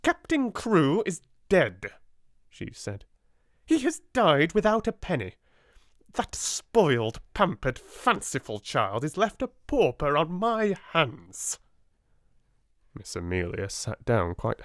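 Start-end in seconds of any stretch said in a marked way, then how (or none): 0.0-1.9 high, slow hard statement; curt. A narrator's reading. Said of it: "wasted no words". 3.7-11.6 bitter anger. A narrator's reading. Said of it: "Miss Minchin furious".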